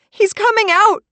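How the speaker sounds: very high, loud warning; crying out. Acted. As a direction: shriek the warning in panic. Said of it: "I cry".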